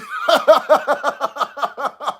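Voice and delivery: high, rapid cackling laugh; big laugh. Real, not acted. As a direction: laugh hard in a high run of ha-has. Laughs at own Kentucky line.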